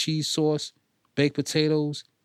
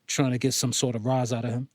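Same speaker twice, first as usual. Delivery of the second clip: usual speaking voice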